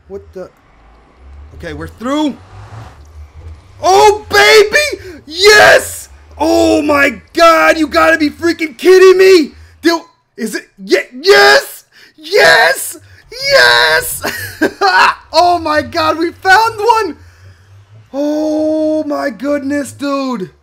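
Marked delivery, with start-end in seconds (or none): 3.8-14.2 ecstatic high-pitched shouting; loud, high victory yells; overly excited. Real, not acted. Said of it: "Maybe I'm getting overly excited". 14.3-15.3 high, loud burst of laughter; real overjoyed laugh; elated laugh. Real, not acted. Finally found the sought biome. 15.4-17.2 high, loud, slow jubilant cry; real triumphant exclamation; overly excited. Real, not acted. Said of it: "Maybe I'm getting overly excited".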